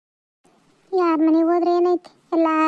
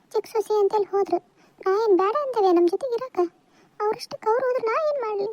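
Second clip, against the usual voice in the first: tearful pleading; 5 semitones higher than usual. Acted. Daughter-in-law defending herself to mother-in-law, tearful and high. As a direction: plead with your mother-in-law as if about to cry. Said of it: "ಯಾಕ್ ಅಳಾಕತ್ತಿ?".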